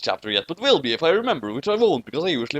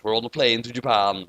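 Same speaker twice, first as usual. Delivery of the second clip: usual speaking voice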